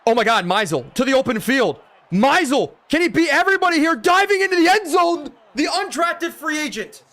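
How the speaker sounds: high, loud play-by-play shout; sportscaster erupting as the runner breaks free; excited touchdown call. Real, not acted. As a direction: build to a screaming touchdown call.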